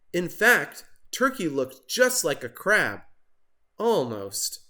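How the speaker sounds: plain reading voice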